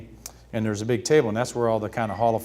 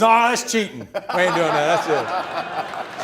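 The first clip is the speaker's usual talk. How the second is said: high, loud comic objection; mock outraged protest; 6 semitones higher, 4 dB louder, slower than usual. Ted Williams refusing the new pitch, comic outrage. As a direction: cry foul in mock outrage.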